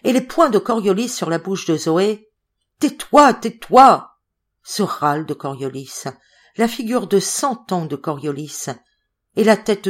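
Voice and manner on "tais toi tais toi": high, loud furious order; angry shout. Acted. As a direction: shout her down by force. Coriolis bâillonne Zoé de force. (cri furieux, aigu et très fort)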